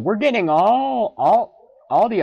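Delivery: high, loud and thrilled; excited. Real, not acted. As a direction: cheer it out loud. Excited catch.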